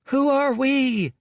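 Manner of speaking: high squeak, slow; squeaky shout. A narrator's reading. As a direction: squeak it at full lungs. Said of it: "squeaked Sun at the top of her lungs".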